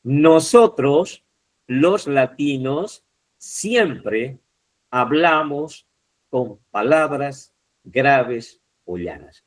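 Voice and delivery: slow dictation, word group by word group; drawn-out, spaced teacher's reading; reading example aloud. Shown as a demonstration. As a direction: read it out slowly with deliberate pauses.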